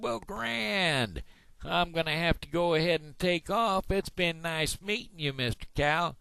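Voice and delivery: very high-pitched cartoon frog; big-mouth frog. Big-mouth frog bids Mister Cow goodbye, high-voiced. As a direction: send the frog's voice way up. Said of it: "I'm the big mouth frog".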